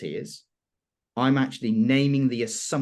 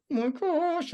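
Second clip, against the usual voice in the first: mock nervous; slower than usual. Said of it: "It's still a bit".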